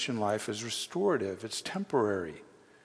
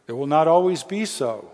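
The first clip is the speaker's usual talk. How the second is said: emphatic promise; 4 semitones higher, 8 dB louder than usual. Real, not acted. Preacher promises congregation suffering will end, loud and emphatic. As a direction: ring it out, loud and higher.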